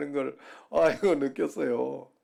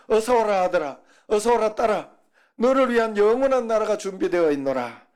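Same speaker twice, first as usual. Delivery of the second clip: higher and louder, grand welcoming Lord; 7 semitones higher, 4 dB louder than usual. Acted. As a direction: call out as the Lord at heaven's gate. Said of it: "주님께서". (천국문에서 맞으시는 주님의 웅장한 목소리)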